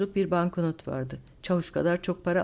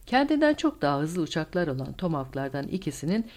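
usual speaking voice

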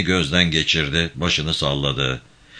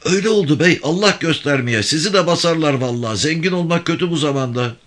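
high-pitched villager, lamenting; villager answering the bey; 11 semitones higher, faster than usual. A narrator's reading. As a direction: agree anxiously in a raised, high voice.

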